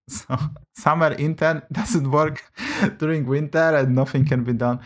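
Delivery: laughs break into the words; chuckling through the joke about a summer intern; laughing. Real, not acted. Streamer jokes to chat about the intern, laughing.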